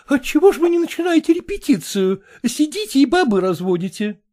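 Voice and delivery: high, brisk society matron; brassy lady voice. A narrator's reading. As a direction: play the bossy choir mistress. Kolkina voiced by reader.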